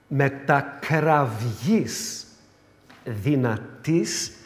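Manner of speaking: each word drawn out very slowly; very slow solemn recitation; solemn slow reading. Shown as a demonstration. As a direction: read the scripture phrase with weight.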